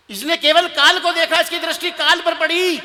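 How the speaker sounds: high, loud, impassioned explanation; emphatic exclamation. Acted. Groom explaining why she fled, emphatic and loud. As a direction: drive the point home loudly. Acted quote, explaining her fearful mistake.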